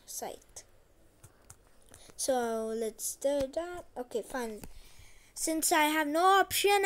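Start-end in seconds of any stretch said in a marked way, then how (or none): 0.1-0.6 a soft whispered Sight. Shown as a demonstration. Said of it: "It's a thing I'm using right now".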